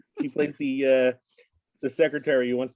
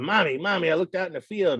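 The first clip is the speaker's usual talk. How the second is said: put-on a light, higher little boy voice; kid voice; 6 semitones higher than usual. Child voiced in joke.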